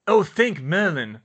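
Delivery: loud, heartfelt exclamation; relieved cry. A narrator's reading. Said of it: "Fred cried".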